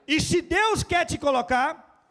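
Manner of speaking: preacher exhorting the congregation, full force; preaching at full force. Real, not acted.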